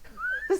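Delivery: real bursting laugh; burst of laughter. Real, not acted. Laughing at own cows story.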